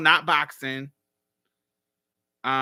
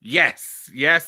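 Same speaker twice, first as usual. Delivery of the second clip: usual speaking voice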